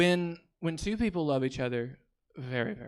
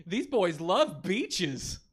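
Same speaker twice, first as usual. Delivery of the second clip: slow, dazzled put-on voice; awestruck first listener; 4 semitones higher, much slower than usual. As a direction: marvel at it, drawn out and dopey. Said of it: "my impression of someone listening to the [name]".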